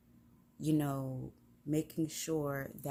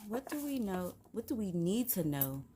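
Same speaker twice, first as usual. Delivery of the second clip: usual speaking voice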